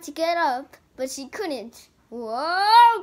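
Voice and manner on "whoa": startled loud whoa; big surprised whoa. Acted. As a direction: cry out as you're shoved. Character knocked aside.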